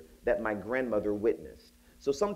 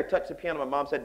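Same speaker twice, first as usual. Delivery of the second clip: ordinary delivery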